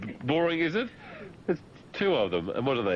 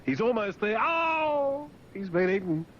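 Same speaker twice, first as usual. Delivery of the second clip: high-pitched excited play-by-play with a wailed "Oh"; mock race commentary; 14 semitones higher, slower than usual. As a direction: commentate a pretend race gone wrong. Mock commentary on shark swim.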